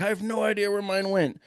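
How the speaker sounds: high, carrying call to a faraway partner; lightly voiced distant shout; retold yell. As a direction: call it out over a long distance. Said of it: "he's yelling".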